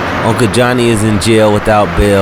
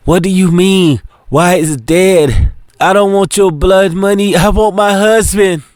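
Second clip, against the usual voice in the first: high, tearful and bitter; grieving protest; 10 semitones higher than usual. Acted. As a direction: push the money away through tears. Grieving wife rejects money.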